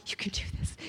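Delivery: whispered pep talk to herself; self-talk. Retold or imitated. Nervous skier coaching herself at the top of the hill, whispered self-talk. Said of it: "I'm literally self-talking to myself".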